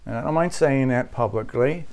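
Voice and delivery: ordinary delivery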